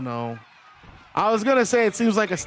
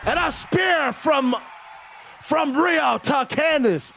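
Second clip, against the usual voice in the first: drawn-out, higher hype call of the spear; excited call; 7 semitones higher, slower than usual. Acted. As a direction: sell the big move like a ringside announcer. Big move in match commentary.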